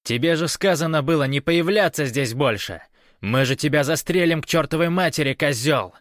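loud, high-pitched scolding; irate guard yelling; barked threat. A narrator's reading. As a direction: threaten an intruder over a loudspeaker. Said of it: "рявкнул динамик".

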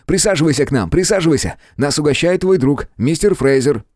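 high, excited hosting; animated, high-voiced invitation; eager welcome. A narrator's reading. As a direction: urge them over to the table excitedly. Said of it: "Он вскочил и придвинул ещё один стул".

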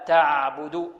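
تعبد drawn out slowly, ع left to flow; slow, careful تعبد with a sustained ع; corrected pron. Shown as a demonstration. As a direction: linger on the ع in تعبد. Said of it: "خطأ، لا".

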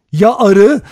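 high, loud challenge; loud, high-pitched cry. A narrator's reading. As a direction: shout the question, pitch jumping up. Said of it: "diye haykırdı Vera".

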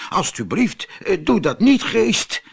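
high, halting plea for mercy; pleading. Acted. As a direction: beg the genie, faltering. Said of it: "smeekte Abdullah".

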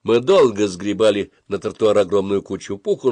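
usual speaking voice

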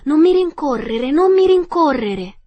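terrified princess shouting; frightened cry. A narrator's reading. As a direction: cry out in alarm to stop a pursuer. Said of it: "Aveva una voce talmente impaurita".

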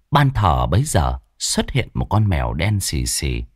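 usual speaking voice

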